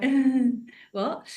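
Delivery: awkward, not-understanding "what?"; awkward confusion. Acted. Grandchild not understanding the relatives, awkward confusion. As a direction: stall awkwardly, lost by the accent. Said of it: "you do that awkward".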